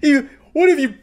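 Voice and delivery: high-pitched hammed-up whining accusation; mock meltdown. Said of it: "then he starts blaming the pizza place".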